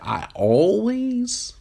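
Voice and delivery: slow, disbelieving I always; incredulous echo. Retold or imitated. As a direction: stretch it out in mock disbelief. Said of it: "And it's just like".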